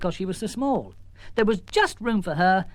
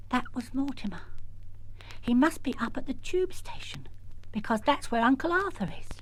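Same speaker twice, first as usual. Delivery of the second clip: lightly voiced little girl; a hint of a girl working it out; child thinking aloud; 7 semitones higher, 4 dB quieter than usual. Girl talks to herself.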